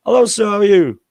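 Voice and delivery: mock street tailor hailing a passer-by; tailor's greeting. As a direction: call out like a Thai tailor. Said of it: "they always go".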